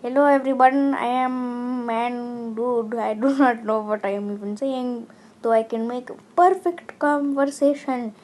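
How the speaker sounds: hammed-up Indian accent, laughing midway. Vlogger showing off impressions, exaggerated Indian accent. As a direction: put on a broad mock Indian accent. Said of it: "stereotypical Indian accent".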